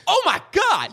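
very high mock-panicked cry; panicked exclamation. Friend imagines a doll kicking the door open, panicked. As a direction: yelp as if the doll burst in.

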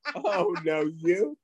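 laughing. Pretend. Laughing at the scene.

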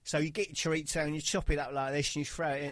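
fast, showy TV cooking host; TV chef. Retold or imitated. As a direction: rattle it off like a telly chef. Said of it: "on the T V and they go".

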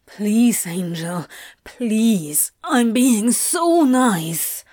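slow, breathless begging; pleading. A narrator's reading. Crowley pleading with his angel, breathless. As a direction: beg him, slow and breathy.

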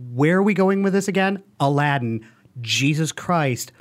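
ordinary talk

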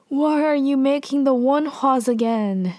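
retold anger. Angry mystery man confronting the queen, mock indignant. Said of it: "he's angry, and he's like".

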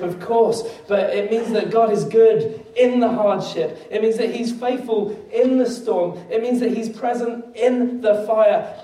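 rising preacher cadence building; preaching. Acted. Pastor building to the congregation, preaching. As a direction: preach it with growing conviction.

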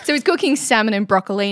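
ordinary talk

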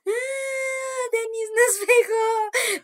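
high, loud, very slow crying complaint; crying children. Retold or imitated. Cousins crying to the parents that Denis hit them, whiny wail. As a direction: wail like kids tattling on her.

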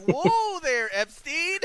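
hammed-up whoa, high and loud; mock alarmed voice. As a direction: pitch it way up, like a scandalised onlooker. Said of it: "And like".

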